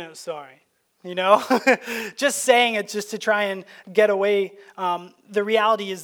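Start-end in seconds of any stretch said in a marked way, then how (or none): 0.0-0.6 softer, grudging mumbled sorry; insincere sorry. Retold or imitated. Sulky child mumbling sorry to get out of trouble. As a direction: say "I'm sorry" under your breath, not meaning it. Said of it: "a not real sorry".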